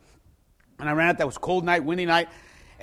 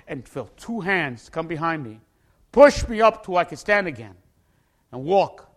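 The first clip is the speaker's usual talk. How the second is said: usual speaking voice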